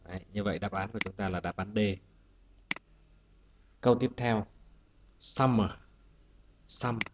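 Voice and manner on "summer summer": "summer" with the stress on "sum"; teacher's model "summer", stressed at the start; first-syllable stress. Shown as a demonstration. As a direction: hit the first syllable of "summer", let the second drop. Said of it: "trọng âm rơi bấm tiết thứ nhất".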